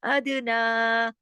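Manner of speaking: slow अदना with echoing d and held naa; stretched naa. Shown as a demonstration. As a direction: draw out the last vowel of अदना. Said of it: "ना को क्यों खींचा?". (अदना के ना को खींचकर)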